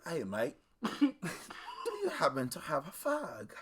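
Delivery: lightly voiced drunk Brit asking politely; mock British accent, casual request; drunk British man. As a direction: imitate a tipsy UK bloke. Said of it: "this guy from the U K".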